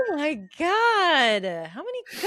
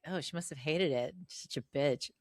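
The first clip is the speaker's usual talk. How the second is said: quiet; 11 semitones lower, 10 dB quieter than usual. Retold or imitated. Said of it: "She was like".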